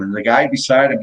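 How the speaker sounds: ordinary talk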